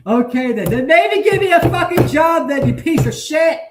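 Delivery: high, loud shouted rant; angry outburst. Real, not acted. Ranting at people who call him normal, angry.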